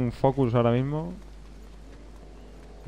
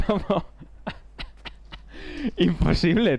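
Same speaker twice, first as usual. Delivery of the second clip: 1 incredulous laugh; 5 semitones higher, slower than usual. Real, not acted. Laughs off the unfair death.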